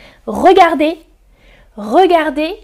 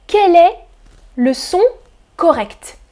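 ordinary delivery

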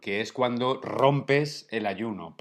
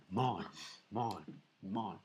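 mock echo; 3 semitones lower, 12 dB quieter, much slower than usual. Echoing their own ham announcement, soft fading mock echo. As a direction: echo dying away softly. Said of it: "¿Y ese eco otra vez?".

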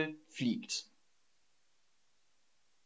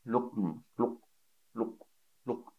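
gulping sound; 2 semitones lower, 8 dB quieter than usual. Shown as a demonstration. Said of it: "wie das Geräusch".

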